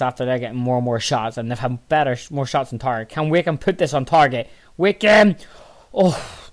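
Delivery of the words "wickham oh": high, loud shout of the player's name; sudden commentator's yell; excited name call. Real, not acted. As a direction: call out the shooter urgently. Calls the player as he shoots.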